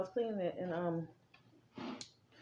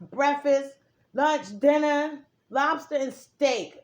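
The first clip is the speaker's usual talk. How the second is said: each meal spaced out and punched louder; slow listing; 3 semitones higher, 7 dB louder, slower than usual. Real, not acted. Telling viewers her dream menu, each meal stressed slowly. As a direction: count the meals off slowly.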